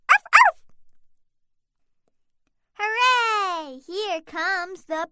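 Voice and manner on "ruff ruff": puppy barking. Acted. Puppy barks.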